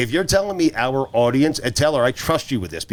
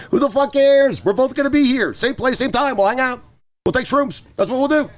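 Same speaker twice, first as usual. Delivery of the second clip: high-pitched ranting shout; too loud; 3 dB louder than usual. Retold or imitated. Retelling his reply to Big Cat, too loud. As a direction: shout it like a hammed-up rant at a buddy. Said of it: "Did I say that too loud?".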